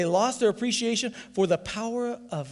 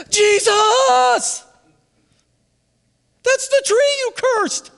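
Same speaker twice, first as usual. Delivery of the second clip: loud, high-pitched full-force yell; Peter's excited shout; 9 dB louder, much slower than usual. Acted. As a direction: holler it out as if running up, stretched and thrilled. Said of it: "Could you imagine Peter? He's running up".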